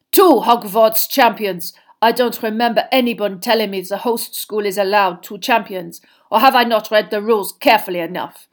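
loud, pointed protest from a cold headmaster; icy and incredulous, forcefully voiced; steely Karkaroff figure, indignant. Rival headmaster challenging the host over two champions, indignant.

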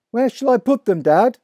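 put-on boy calling to his father in the wood. A narrator's reading. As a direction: call out to Dad. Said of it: "I called out".

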